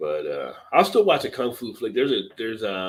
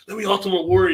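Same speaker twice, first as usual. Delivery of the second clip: excited; 10 semitones higher, 6 dB louder, faster than usual. Retold or imitated.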